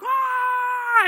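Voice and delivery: long, high, loud re-enacted scream; mock scream. Riders suddenly released on the sky swing, long scream. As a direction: scream like you're plunging on a giant swing. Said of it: "I'm screaming my head off".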